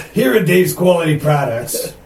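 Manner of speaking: loud, high, bad Boston accent; hammed-up Boston accent pitchman. Shown as a demonstration. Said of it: "the worst Boston accent".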